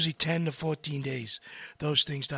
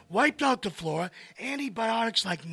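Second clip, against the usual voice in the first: normal talking voice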